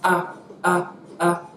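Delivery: short AH, brought down in pitch; falling tone. Shown as a demonstration. Teacher showing Mandarin fourth tone, falling AH. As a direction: drop each AH in a quick, separate burst. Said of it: "The fourth comes down".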